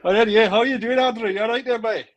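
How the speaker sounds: bouncy mock Scottish hello; mock Scottish greeting. Ben answers the Scottish-accent prompt.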